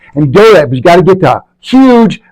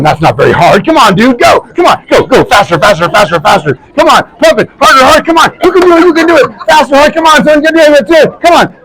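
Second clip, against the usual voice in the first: imitated coach egging on; 9 semitones higher than usual. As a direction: push him like a drill coach.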